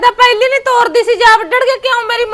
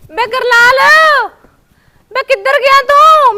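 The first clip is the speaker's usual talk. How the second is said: calling out; 4 semitones higher, 4 dB louder, slower than usual. Acted. Daughter calls out for brother.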